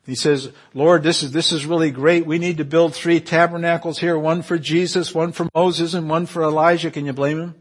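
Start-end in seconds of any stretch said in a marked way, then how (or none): 0.7-6.9 higher and louder, eager and rushing; excited Peter. Acted. Peter urging Jesus to build tabernacles, excited. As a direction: gush like a man who wants the moment to last. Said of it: "Peter gets really excited".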